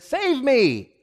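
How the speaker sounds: high-pitched plea; urgent, acted cry; cry for help. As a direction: call out to be rescued. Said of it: "originally meant".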